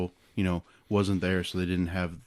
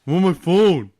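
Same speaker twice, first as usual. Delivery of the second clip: loud high defensive yell; yelling across the room; 8 semitones higher, 9 dB louder than usual. Pretend. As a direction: shout back defensively. Son skit yell.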